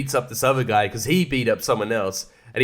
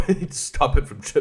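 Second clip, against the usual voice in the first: ordinary delivery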